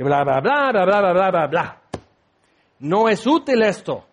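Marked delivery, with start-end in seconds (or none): 0.0-1.8 dismissive blah-blah in a put-on voice; mock rambling. Preacher mocks his own complaint under the truck, dismissive babble.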